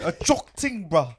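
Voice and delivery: put-on London slang accent. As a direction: echo it London style. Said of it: "English accent".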